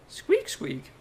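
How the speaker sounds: high squeaky mouse noise; mouse squeak. Retold or imitated. Player imitates a mouse, high squeaky squeak.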